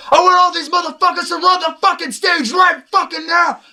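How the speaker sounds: high full-throated shout. Retold or imitated. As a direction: yell it out, imitating a rapper firing up a crowd. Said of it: "I hear [name] goes".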